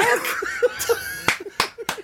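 airy high giggle of disbelief; breathless disbelieving laugh. Real, not acted. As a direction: laugh in delighted shock. Opening surprise gift.

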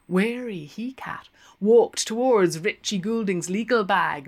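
plain reading voice

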